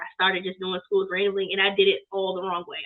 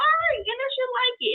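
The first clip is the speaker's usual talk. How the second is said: much higher, pleased surprise; mock surprised self. Quoting herself seeing NSU fans buy, high and pleased. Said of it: "I was like".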